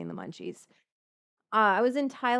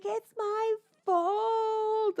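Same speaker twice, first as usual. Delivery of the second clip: high, slow, tearful wail; retold crying; 12 semitones higher, much slower than usual. Blaming herself to the vet, crying. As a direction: sob it out like it's all your fault. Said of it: "burst into tears this morning".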